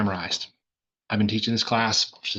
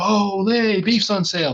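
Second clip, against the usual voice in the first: high, loud happy shopper; delighted shopper; 11 semitones higher, 6 dB louder, slower than usual. Acted. Teacher voicing a shopper thrilled by a beef sale, high and loud. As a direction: cheer it like a bargain hunter. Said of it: "they go".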